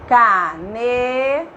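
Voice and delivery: ca and ne held long and apart; syllable by syllable. Shown as a demonstration. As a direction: stretch each syllable of "Cane-" for the vowel. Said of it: "qual é a vogal que eu ouço?".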